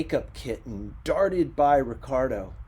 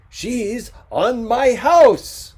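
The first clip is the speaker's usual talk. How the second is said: loud, high, very slow outrage; outraged; 11 semitones higher, 15 dB louder, much slower than usual. Acted. Ricardo protesting to the photographer, outraged and slow. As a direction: spell out each word in fury.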